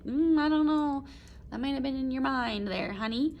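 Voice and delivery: a hint of a wife soothing her husband; anxious wife. Wife doubting her husband saw the paw move, a touch anxious. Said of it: "regarding him anxiously".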